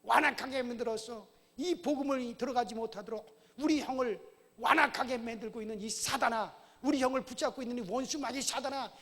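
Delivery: loud, rising command to the devil; commanding prayer. Retold or imitated. Brother praying against Satan holding his brother, loud commanding prayer. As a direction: bind and cast out in Jesus' name. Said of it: "한 시간 동안".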